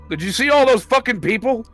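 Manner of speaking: ordinary talk, nothing marked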